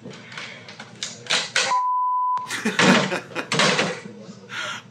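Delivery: high breathy laugh bursting out; real burst of laughter. Real, not acted. Big laugh at the footage.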